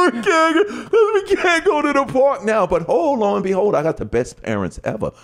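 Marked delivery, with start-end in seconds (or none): 0.1-2.7 mock crying. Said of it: "we cried".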